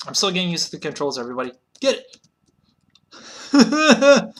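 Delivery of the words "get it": loud, high yell of urging; real excited yell; excited shout. Real, not acted. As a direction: shout it at the buffalo. Urging a shot at buffalo.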